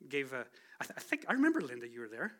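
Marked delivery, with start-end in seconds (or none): none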